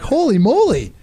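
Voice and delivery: a dumb caveman amazed his trick worked; amazed delight. Retold or imitated. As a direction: gasp it out like you just found a miracle. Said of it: "I'm like".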